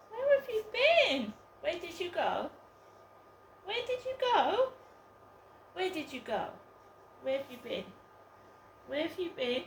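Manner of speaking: high, sing-song pet voice calling the cat. Real, not acted. Owner cooing to her lost cat, high baby talk.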